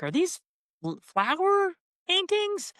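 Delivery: high, mock-incredulous tease; playful teasing. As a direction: tease the kid with big fake disbelief. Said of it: "my playfulness".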